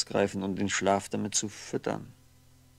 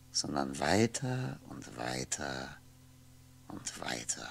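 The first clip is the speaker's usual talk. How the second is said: fading away; 6 semitones lower, 7 dB quieter, slower than usual. Acted. Drifting off over the pass.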